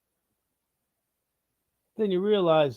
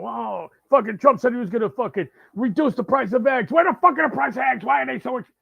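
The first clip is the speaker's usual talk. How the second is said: loud sarcastic rant voice; mock the complainer, louder and higher; mocking liberal; 5 semitones higher, 5 dB louder than usual. Mocking anti-Trump complaint.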